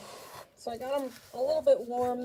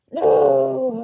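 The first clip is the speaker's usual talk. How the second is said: frustrated cry; 3 semitones higher, 14 dB louder than usual. Real, not acted. Phone call interrupts recording.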